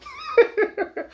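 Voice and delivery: high-pitched giggle; laugh. Real, not acted. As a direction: break into a quick, high laugh. Laughs between two takes.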